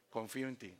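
very soft, low, meek murmur; humble child's trust. Acted. Child leaning on provider dad, soft and trusting. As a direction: say it small and trusting.

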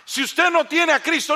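loud, high, slow proclamation to the congregation; full-force preaching. Acted. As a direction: preach it out, hammering each phrase.

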